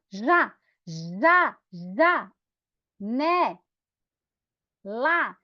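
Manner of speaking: "Já, já, já. Né? Lá" in isolated beats with long gaps; syllable by syllable. Shown as a demonstration. Teacher helping children count mouth openings, syllable by syllable. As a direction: sound out each of "Já, já, já. Né? Lá" separately. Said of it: "Só levanta o dedinho quando a sua boca abrir".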